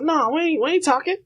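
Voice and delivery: high mock old-lady refusal; grandma voice. Grandma refusing to talk, high mock voice. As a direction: pitch it way up like a feisty grandma. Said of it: "she was like".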